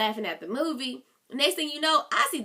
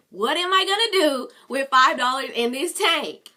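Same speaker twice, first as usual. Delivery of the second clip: louder, exasperated complaint about the five dollars; angry; 3 semitones higher, 7 dB louder than usual. Retold or imitated. A driver fuming at a friend over five dollars of gas, loud and angry. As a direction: let the frustration boil over. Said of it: "I was pissed".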